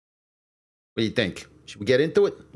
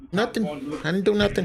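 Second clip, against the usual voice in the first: higher, playing innocent; the pet's guilty higher voice denying everything; 10 semitones higher, faster than usual. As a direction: squeak out a fake denial, higher. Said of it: "You're gonna be like".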